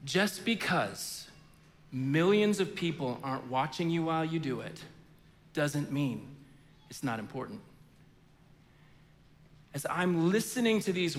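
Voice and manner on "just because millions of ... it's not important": quiet sincere. Retold or imitated. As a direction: say it gently, letting each word land. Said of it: "she says to him".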